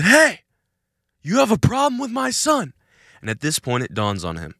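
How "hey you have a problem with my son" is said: high, loud repeated challenge; shouting again. Retold or imitated. Dad shouts the threat again at the attacker. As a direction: yell it over again, harder. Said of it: "my dad shouts it again".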